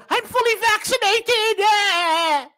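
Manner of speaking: high slow whiny mock voice. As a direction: parody the smug vaccinated woman. Mock quote again.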